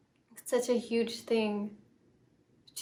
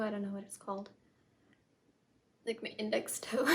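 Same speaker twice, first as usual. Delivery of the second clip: a hushed, unsure whisper; whispered aside; 6 dB quieter, faster than usual. Real, not acted. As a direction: drop to a whisper as if to yourself. Unsure aside about toe name.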